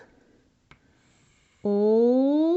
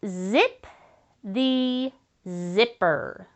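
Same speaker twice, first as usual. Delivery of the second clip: each word slow and spaced for the class; slow dictation; much slower than usual. Shown as a demonstration. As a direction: dictate it slowly so children can follow. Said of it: "Say that with me".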